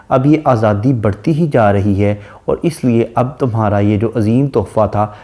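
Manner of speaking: normal talking voice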